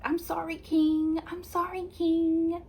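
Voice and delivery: high, put-on sugary voice, mocking; fake sweet apology. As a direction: simper the apology like you don't mean it. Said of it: "it's giving fake".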